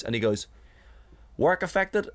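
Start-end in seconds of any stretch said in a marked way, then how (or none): 1.4-2.1 high Northern Irish question; a Northern Irish butcher asking; imitating a Northern Irish butcher; Northern Irish accent. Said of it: "I'm just giving him a generic Northern Ireland accent".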